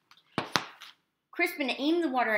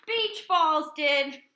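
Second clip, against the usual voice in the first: slow reveal; 8 semitones higher, 7 dB louder, much slower than usual. A narrator's reading. Punchline of the joke.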